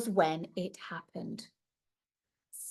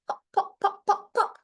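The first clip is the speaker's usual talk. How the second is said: popping; 10 semitones higher than usual. A narrator's reading. Fibs popping into blobs.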